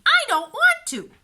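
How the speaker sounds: put-on high, loud whiny child voice; a stubborn little sister refusing; whiny little girl. A narrator's reading. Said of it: "answered Beatrice".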